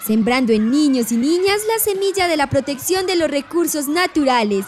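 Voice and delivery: put-on high, sweet voice for small children; kindergarten teacher voice. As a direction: talk like a preschool teacher. (voz de maestra de preescolar)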